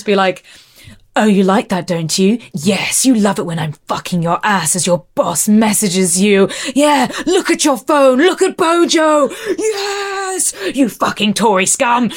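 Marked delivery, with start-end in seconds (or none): 1.2-12.1 hint of posh British dom; lightly played posh British dominant; posh British accent. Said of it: "posh British voice".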